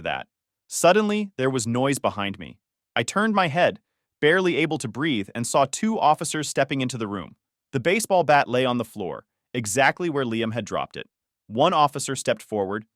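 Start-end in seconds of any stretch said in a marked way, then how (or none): none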